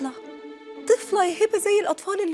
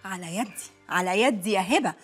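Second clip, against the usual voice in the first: much lower dry mock agreement; sarcastic aside; 10 semitones lower than usual. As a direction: drop low and deadpan.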